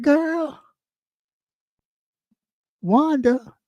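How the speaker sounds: high sing-song call across the room; calling for Wanda. Pretend.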